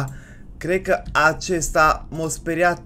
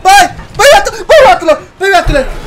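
high, loud alarmed shouting; frantic panic; 8 semitones higher, 10 dB louder than usual. Real, not acted. Player sees the enemy gone rabid, frantic shouting. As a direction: shout in mounting fright.

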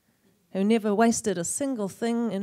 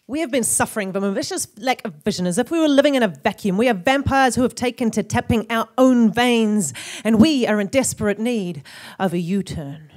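fast, driving slam-poetry delivery, rising in volume; impassioned poetry; 4 dB louder than usual. Acted. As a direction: build the indictment in a rapid rush, easing at the end. Poem builds to an indictment.

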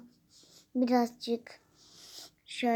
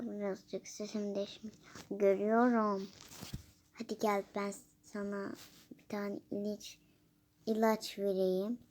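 ordinary talk, nothing marked

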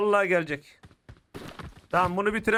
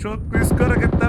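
tense excitement; 7 dB louder than usual. Real, not acted. Tense gaming moment.

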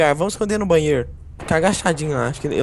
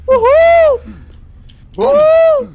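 falsetto whoop; 8 dB louder, much slower than usual. Pretend. Doing a dance.

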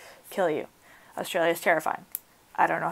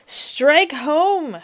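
a loud crowd cry, pitched up; narrated crowd yell; crowd scream; 7 semitones higher, 4 dB louder than usual. A narrator's reading. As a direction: shout it like a mob urging the hero on. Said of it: "screamed the crowd".

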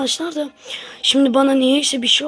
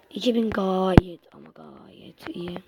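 whisper; 5 semitones lower, 7 dB quieter, slower than usual. Real, not acted. Pleased aside, whispered.

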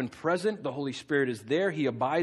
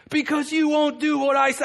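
high, loud mock exasperation; imitated angry retort; 9 semitones higher, 5 dB louder than usual. Balaam answering the talking donkey, mock angry retort. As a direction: fire back in acted anger without missing a beat. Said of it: "You ever been that angry?".

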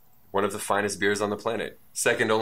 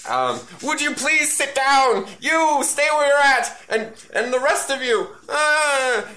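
very high, louder squeaky Keith voice; NPC voice; 4 dB louder, slower than usual. Acted. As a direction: shriek it out high like a panicked little villain. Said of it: "Keith is like".

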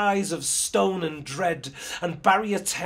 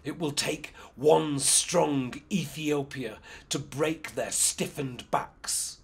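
growled. Acted. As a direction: growl it out like a king. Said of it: "Menelik growled".

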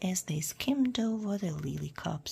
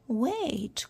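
higher-pitched calling frog; lightly croaked plea, pitched up; 6 semitones higher than usual. A narrator's reading. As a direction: call after someone as a frog. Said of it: "croaked the frog".

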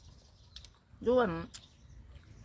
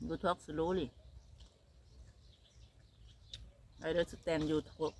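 soft quoted husband; 3 semitones lower, 10 dB quieter, much slower than usual. Retold or imitated. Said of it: "เขาบอก".

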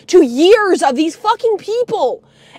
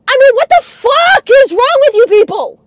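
higher-pitched, raised angry disbelief; exasperated outburst; 5 semitones higher, 4 dB louder than usual. Real, not acted. Appalled at the family after a story, exasperated outburst. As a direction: burst out in outraged disbelief.

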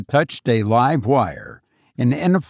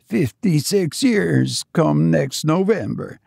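put-on high, tearful-sounding old servant, understated; moved old retainer, voice lifted; faltering voice; 5 semitones higher than usual. A narrator's reading. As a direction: answer high with a touch of emotion. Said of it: "his voice faltered".

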